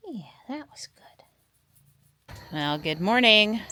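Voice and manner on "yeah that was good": high, soft whisper to a pet. Real, not acted. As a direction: breathe it out tenderly.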